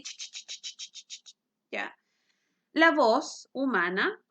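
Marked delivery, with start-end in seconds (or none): 0.0-1.4 soft unvoiced ch ch ch, quieter; imitated wave noise. Shown as a demonstration. Teacher imitates a violin wave for the class, soft scratchy ch sounds. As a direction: whisper a scratchy ch ch ch like a messy wave. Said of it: "va así".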